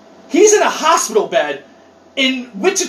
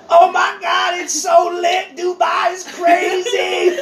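put-on drugged kid; 10 semitones higher, slower than usual. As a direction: imitate a kid raving out of anesthesia, stretching the last word. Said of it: "that redheaded kid? He's l-, coming out of an anesthesia".

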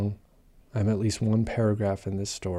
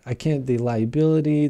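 ordinary talk

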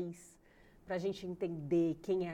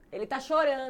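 raised, high mother voice; imitated nagging alarm; 6 semitones higher, 7 dB louder than usual. Grandmother reports crying baby to showering mother, raised and urgent. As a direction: call it out like an anxious grandmother.

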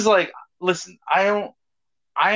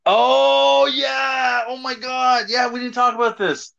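excited outburst; 8 semitones higher, slower than usual. Real, not acted. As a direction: blurt it out as the memory hits. Suddenly remembers the predator story.

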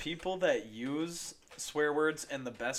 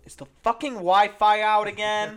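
high, loud, slow angry demand; loud angry rant; 8 semitones higher, 12 dB louder than usual. Pretend. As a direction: yell at the dead Wi-Fi. Acted roommate rant.

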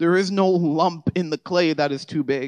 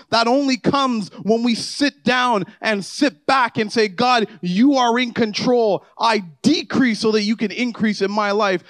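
louder and higher, driving sermon climax; preaching at full force; 6 semitones higher, 4 dB louder than usual. Acted. Pastor urging the congregation about transformation, fervent preaching. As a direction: build it up and lift the room.

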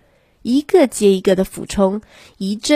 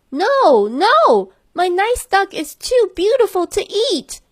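put-on child crying out; 12 semitones higher than usual. A narrator's reading. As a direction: cry out high to save the duck. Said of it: "cried the boy".

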